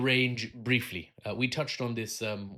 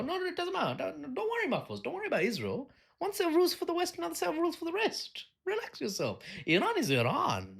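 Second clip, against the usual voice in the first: very high, silly falsetto; mock dismissive apologist. Apologist waving away worries about Israel, mock falsetto reassurance. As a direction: wave it away in a squeaky, placating put-on voice. Mocks Western excuses for double standard.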